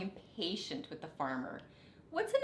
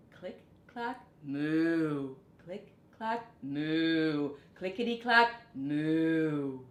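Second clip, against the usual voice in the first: typewriter and moo sounds; 3 semitones lower, 4 dB louder, much slower than usual. A narrator's reading. Typing and mooing sound effects.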